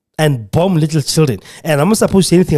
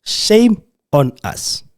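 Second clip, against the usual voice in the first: higher and very slow, each word drawn out; slow shame; 7 semitones higher, much slower than usual. Real, not acted. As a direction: let the shame sink in slowly. Repeated condemnation, slowed.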